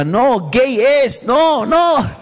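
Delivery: drawn-out, rising cries of warning; stretched high mock yell; mock divine shouting. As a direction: call it out high and slow, imitating God. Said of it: "Dios te puede estar gritando".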